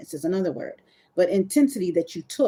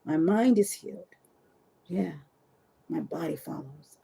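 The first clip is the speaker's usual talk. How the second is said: quiet mantra; 3 semitones lower, 6 dB quieter than usual. Shown as a demonstration. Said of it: "literally what I say".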